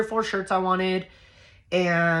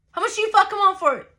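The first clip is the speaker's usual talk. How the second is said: high, fast, louder outburst; furious repeated demand; 13 semitones higher, 4 dB louder, much faster than usual. Retold or imitated. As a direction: explode with the demand, hitting every word. Repeats demand, escalated, in quarrel.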